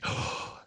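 voiceless gasped "oh"; awestruck gasp. Retold or imitated. Storyteller reliving hearing the song, awestruck gasp. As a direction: re-enact a sudden gasp of delighted recognition. Retold awed recognition of song.

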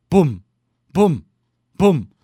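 loud, high booming thumps; insistent pounding. A narrator's reading. Visitor knocks at sleeping Bear's door, insistent pounding. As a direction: pound each one harder, like knocking.

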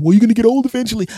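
put-on high, loud, fast snotty kid; mock kid retort. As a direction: sass him like a brat.

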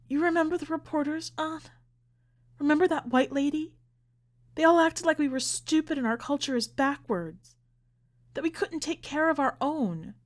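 high, pained voice of grievance; upset, aggrieved. A narrator's reading. Upset mother recalls how they were treated.

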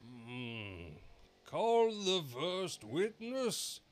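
slow, high, booming king; drawn-out royal command. A narrator's reading. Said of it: "said the king".

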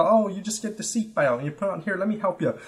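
a touch of a sweet helper; imitating her. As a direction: play the kindly helper lightly.

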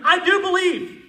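high, loud scream of faith; desperate father screaming to Jesus. Shown as a demonstration. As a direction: scream it with everything in you. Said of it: "he's screaming with everything within him".

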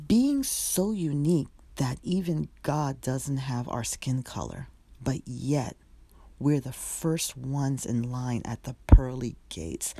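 poem reading. Acted. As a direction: recite it like a slam poet on stage.

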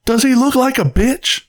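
high-pitched, acted-out interrogation; movie quote. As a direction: throw it out as a famous film line. Acted Pulp Fiction movie quote.

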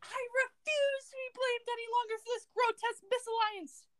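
high, hammed-up British outrage; theatrical royal tirade, British accent; attempted British accent. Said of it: "your attempt at a British accent".

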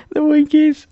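very high, loud squeal after a laugh; silly squeak. Acted. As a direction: squeak the funny name out. Reader laughs, repeats funny name squeakily.

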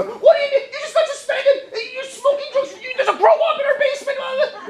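mock hysterical parent screeching through laughter; exploding parent. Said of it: "they can't contain themselves".

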